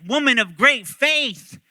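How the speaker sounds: loud, high, slow proclamation; emphatic acclaim. Retold or imitated. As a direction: proclaim it with great admiration. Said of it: "he doesn't just like".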